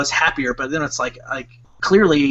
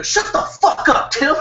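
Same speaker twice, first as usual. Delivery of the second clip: very high, strained mock yell; retold yell; 12 semitones higher than usual. Boss yelling at Tim as he walks in, mock yell. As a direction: replay the boss's outburst in a squeezed yell. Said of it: "yelling at Tim".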